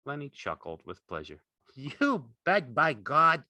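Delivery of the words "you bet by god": loud, high, delighted exclamation; happy cry. A narrator's reading. Lenny agrees he likes feeling velvet, happy cry. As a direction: burst out happily. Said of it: "he cried happily".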